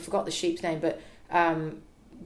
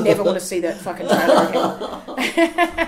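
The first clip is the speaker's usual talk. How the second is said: louder, slow, laughing declaration; laughing exasperation; 8 dB louder than usual. Retold or imitated. Swearing off the broken trailer, loud and slow through laughter. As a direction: spit it out through a laugh, fed up. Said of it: "I was like".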